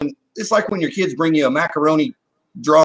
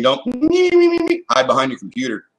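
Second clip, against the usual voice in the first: mock scold; 12 semitones higher, slower than usual. Real, not acted. Scolding online bullies.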